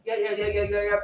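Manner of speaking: low mock mumble; low, closed-mouth yammer; self-conscious talker. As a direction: mumble as if hiding bad teeth. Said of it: "you're no longer talking like this".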